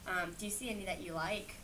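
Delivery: ordinary delivery, nothing marked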